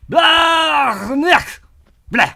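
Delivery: alien gibberish. Acted. Mock alien tongue counting.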